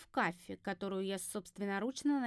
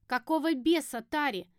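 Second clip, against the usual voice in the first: louder, voiced angry demand; sharp, higher accusation; angry hissing; 4 semitones higher, 6 dB louder than usual. A narrator's reading. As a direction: round on a traitor, indignant. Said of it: "теперь зашипела уже на соседку".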